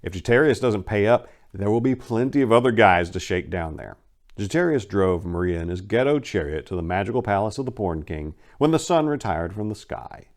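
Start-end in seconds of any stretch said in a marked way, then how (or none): none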